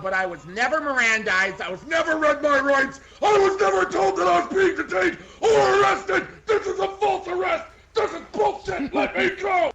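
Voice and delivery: rising to high, loud indignant shouting; arrested woman yelling at the trooper about her rights; outraged protest. Acted. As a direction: build from complaint to a furious yell.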